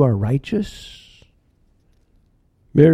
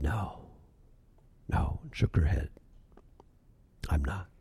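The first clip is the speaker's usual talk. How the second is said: barely audible, much softer and lower; hushed; 7 semitones lower, 14 dB quieter than usual. A narrator's reading. Mary answering the stranger in the softest hush. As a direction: hush it to almost nothing. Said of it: "in the hushest of sounds".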